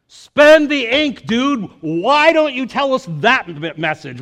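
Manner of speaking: half-joking cry to the author; exasperated plea. Real, not acted.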